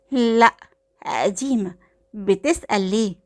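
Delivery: ordinary delivery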